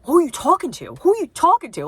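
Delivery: bewildered, asking it twice; mock baffled husband questioning his fake wife's ad pitch; imitating confused husband. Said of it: "he's looking around, he's like".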